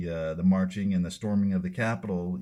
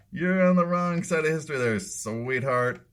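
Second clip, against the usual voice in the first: higher, louder, fast condescending sneer; high patronizing mock; 8 semitones higher, 5 dB louder than usual. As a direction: talk down to her like a child. Mocking repeat of her line.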